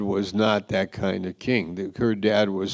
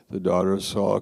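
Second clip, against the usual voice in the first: ordinary delivery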